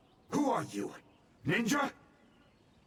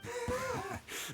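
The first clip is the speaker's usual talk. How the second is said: mocking laugh; 6 dB quieter than usual. Villain laughs at the hostage threat.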